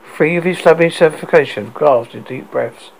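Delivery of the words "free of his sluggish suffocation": usual speaking voice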